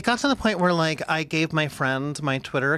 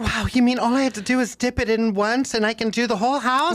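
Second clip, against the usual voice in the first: put-on wonderstruck, higher Australian accent; Australian princess; 5 semitones higher than usual. Princess learning about the mop, amazed Australian accent. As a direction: marvel as a hammed-up Australian princess. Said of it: "she was an Australian princess".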